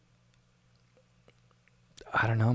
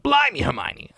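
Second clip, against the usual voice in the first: put-on high-pitched, rough British accent; hammed-up British exclamation; attempted British accent; 13 semitones higher than usual. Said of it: "can't do the accent".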